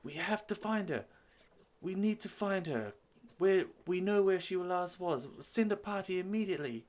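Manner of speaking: high, urgent, insistent demand; desperate prince. Acted. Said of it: "hammering on the table".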